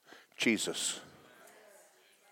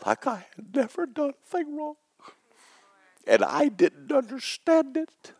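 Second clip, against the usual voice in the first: choked, high crying plea to God; tearful; 12 semitones higher, 5 dB quieter than usual. Real, not acted. Pastor weeping as he tells the church of his salvation. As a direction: voice breaking high with tears.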